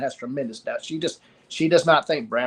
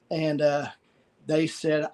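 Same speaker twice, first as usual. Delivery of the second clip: ordinary delivery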